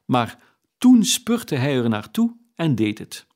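usual speaking voice

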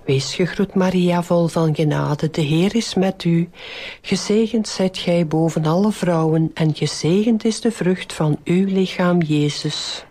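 even, measured rosary lead; devout, rote Hail Mary cadence; prayer recitation. Real, not acted. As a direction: recite a well-known prayer in steady phrases.

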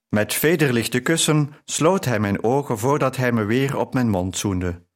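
plain reading voice

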